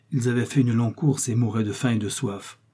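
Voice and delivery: ordinary delivery, nothing marked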